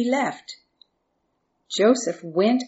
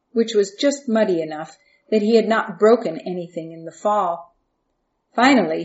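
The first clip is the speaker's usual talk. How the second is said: ordinary delivery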